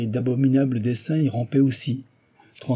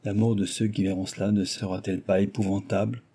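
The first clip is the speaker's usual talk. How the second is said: ordinary delivery, nothing marked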